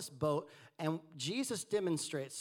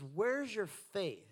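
gentle correction; 5 semitones higher, much slower than usual. Retold or imitated. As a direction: ask it slowly, like a patient teacher. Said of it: "in a gentle correction".

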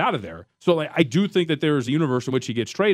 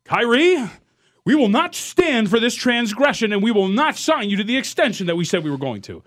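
stern team bosses; 6 semitones higher, 3 dB louder than usual. Retold or imitated. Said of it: "you're like".

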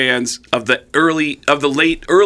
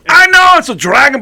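high, loud exasperated holler; 12 semitones higher, 8 dB louder than usual. Retold or imitated. Singer conceding to drummer it's a dragon, shouting. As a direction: shout over the drummer's objection. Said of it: "He goes".

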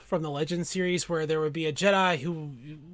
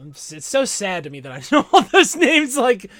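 louder, higher, breaking into a laugh; laughing self-mockery; 5 semitones higher, 10 dB louder than usual. Real, not acted. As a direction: laugh at yourself as you confess. Laughing at own nerdy knowledge.

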